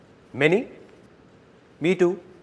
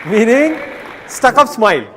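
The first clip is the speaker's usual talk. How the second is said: mock stifled smile; 8 semitones higher, 7 dB louder than usual. Mocking a held-in laugh.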